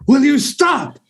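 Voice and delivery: high, exasperated movie-line voice; fed up, pitched high, ham it up; Scrooged quote. Retold or imitated. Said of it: "the line that we always do from Scrooged".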